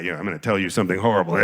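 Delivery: a hint of Italian joke teller, rattled off. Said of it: "How does every Italian joke start? Like that".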